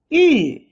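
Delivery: "yu" falling from high. Shown as a demonstration. Teacher leads repeat drill, falling yu. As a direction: drop "yu" from the top. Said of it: "The fourth tone is the falling tone".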